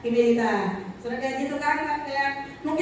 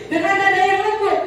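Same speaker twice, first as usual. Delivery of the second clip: laughing repeat; 6 semitones higher, 5 dB louder than usual. Real, not acted. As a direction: say it again laughing, louder. (mengulang sambil tertawa)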